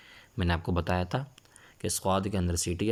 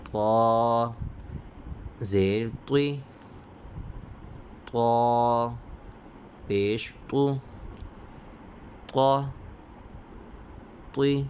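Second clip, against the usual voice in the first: Qaida recitation drill; 4 semitones higher, much slower than usual. Shown as a demonstration. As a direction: call out the letters so the class can repeat. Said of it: "جو حرف کے اوپر میں انگلی رکھتا ہوں".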